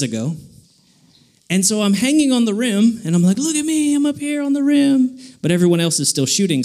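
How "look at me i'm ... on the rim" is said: high-pitched, crowing boast; pitched way up, look-what-I-can-do brag; showing off. Retold or imitated. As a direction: crow it like a kid showing off. Shows off hanging from rim.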